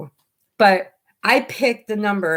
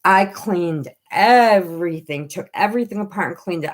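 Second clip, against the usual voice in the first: ordinary talk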